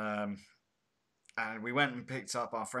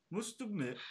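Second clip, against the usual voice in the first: put-on higher-pitched second stab at Andy's accent; botched imitation of Andy's accent; 9 semitones higher than usual. Co-host retries absent Andy's voice, high failed accent. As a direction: mimic a friend's accent, pitched up and unconvincing. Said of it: "I can't do it either".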